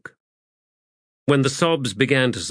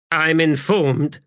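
high, measured interruption; cutting in; 7 semitones higher, 4 dB louder than usual. A narrator's reading. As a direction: cut across them with slow authority. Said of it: "cut in the adjunct".

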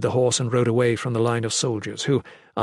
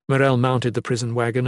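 plain reading voice

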